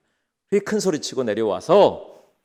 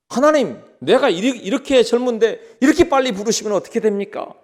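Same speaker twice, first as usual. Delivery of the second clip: higher-pitched, protesting to God; indignant young man; 9 semitones higher, 4 dB louder than usual. Acted. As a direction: act out an aggrieved young man's complaint. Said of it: "너무 화를 내는 겁니다". (억울해서 따지는 청년)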